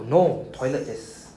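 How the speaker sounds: "No, toilet desu" said slowly and deliberately; teacher explains the answer to students, slowly; slow gloss. Shown as a demonstration.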